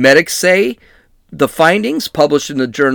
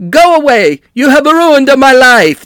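loud put-on Italian shouting; angry Italian man; 9 semitones higher, 6 dB louder than usual. A dentist yelling at reporters, loud Italian voice. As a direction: shout him off like a cornered Italian. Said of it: "this is what Russo said".

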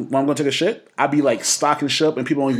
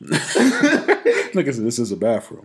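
laughing; 3 semitones lower, slower than usual. Retold or imitated. Retold fart excuse, laughing.

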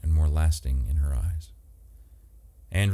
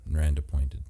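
usual speaking voice

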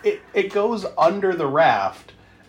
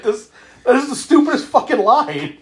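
laughing disbelief; 8 semitones higher, 3 dB louder than usual. Real, not acted. Mocking the film's dialogue.

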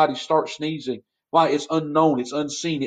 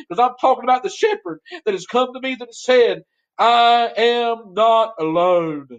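higher, impassioned preaching; 8 semitones higher than usual. Acted. Pastor admits he can't help preaching, impassioned. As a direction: preach it out, carried away.

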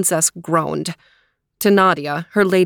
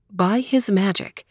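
plain reading voice

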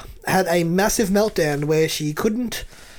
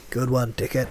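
low, softer attempt at a computer voice; attempted Big Brother; 7 semitones lower, 5 dB quieter than usual. Trying the catchphrase in the game voice, low and sinister. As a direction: drop down for a half-hearted sinister host. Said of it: "No, I can't do it".

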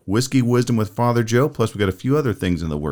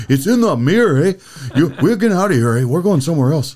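mock Canadian accent, higher and louder, with "eh"; Canadian hoser voice; 6 semitones higher, 4 dB louder than usual. Quoting the hosers leaving the restroom, a hammed-up Canadian accent. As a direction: hurry it out like a fleeing Canadian stoner. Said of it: "they were still talking that way".

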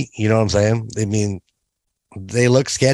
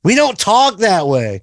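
mock protest; 10 semitones higher, 3 dB louder than usual. Mock protest after accent request.

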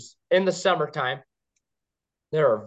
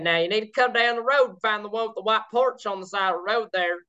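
lightly put-on Kentucky accent, pitched higher; imitated local woman; 5 semitones higher than usual. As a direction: imitate her country directions in a higher voice. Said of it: "She said".

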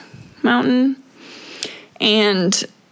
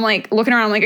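ordinary delivery

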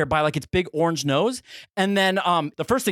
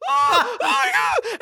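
very high screaming squeals; pig squeal; much slower than usual. Retold or imitated. Storyteller reaching the screaming kid, imitated pig squeals. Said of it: "Do you like the pig squeal".

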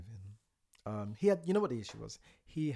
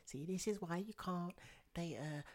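imitating straight viewers; 7 semitones higher than usual. Mocking straight viewers judging bi men, pitched up. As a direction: parody a judgmental viewer, pitched up.

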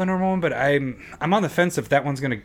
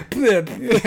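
put-on high, kid-like "bleh" noise; imitated spitting bleh; 7 semitones higher than usual. Dad imitating his son after the mishap, spluttering. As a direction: mimic a child spluttering. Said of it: "he's just like".

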